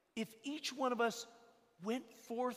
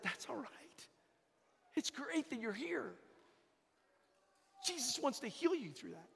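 gentle reassurance; 7 dB quieter than usual. Retold or imitated. Priest reassures worried father.

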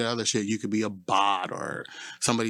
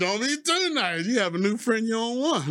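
high quote voice; 11 semitones higher than usual. Retold or imitated. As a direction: squeak it back like she said it. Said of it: "she said".

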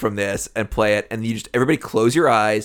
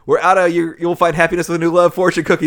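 louder, higher acted announcement; raised voice of a worker reporting a shortage; mock calling out; 5 semitones higher, 5 dB louder than usual. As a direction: call it out like kitchen staff. Restaurant guy calls from the back.

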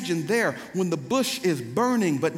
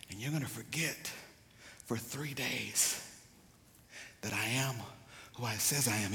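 soft, low delivery with long pauses; hushed solemn preaching; 6 semitones lower, 7 dB quieter than usual. Real, not acted. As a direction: drop right down and let each phrase sit.

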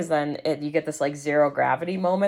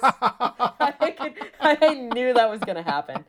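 laughing; 8 semitones higher, slower than usual. Real, not acted. Laughing at own story.

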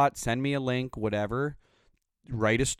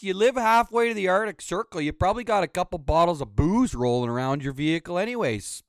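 fed-up rant at a whiny customer; angry rant; 9 semitones higher than usual. Real, not acted. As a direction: tear into him, voice up and hard. Ranting at a stranger online.

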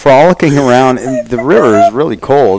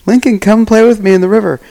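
imitating Cooper; 10 semitones higher than usual. Friend calling from the glacial river, high hammed-up invitation. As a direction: squeak it out like a giddy friend waving everyone into the water. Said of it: "he's like".

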